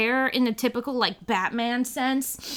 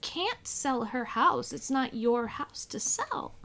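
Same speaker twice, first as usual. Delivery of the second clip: slow, soft, half-whispered; hushed retort; 8 dB quieter, slower than usual. Retold or imitated. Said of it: "And I'm like".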